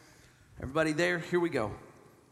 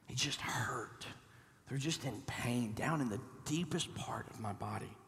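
quiet hushed pain; 5 semitones lower, 9 dB quieter than usual. Acted. Describing deep pain quietly.

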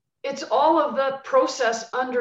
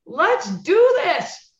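higher, louder, pumped-up burst; excited; 7 semitones higher, 6 dB louder, much slower than usual. Retold or imitated. Coach answering the player's goal, excited and loud. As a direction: fire up like a coach ready to go. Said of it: "I'm like".

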